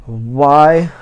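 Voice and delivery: exasperated lament. Real, not acted. Laments having to reread book.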